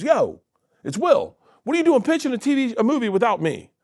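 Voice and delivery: high, mock-offended phone call. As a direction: tease him like a hurt friend. Will calling him on phone.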